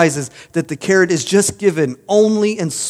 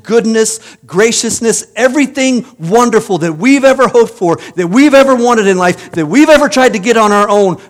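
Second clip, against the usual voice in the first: louder, higher preaching that builds in force; building sermon; 4 semitones higher, 6 dB louder than usual. Acted. Pastor lifts the congregation toward hope, louder and higher. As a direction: lift the congregation from the darkness to the good news.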